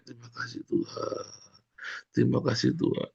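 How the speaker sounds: hushed grateful whisper; whispered thanks. Shown as a demonstration. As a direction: breathe the thanks softly. (bisikan syukur pelan)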